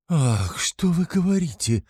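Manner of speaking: high-pitched gasping exclamation of alarm; aghast mother, pitched well up; horrified. A narrator's reading. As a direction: recoil at dreadful news.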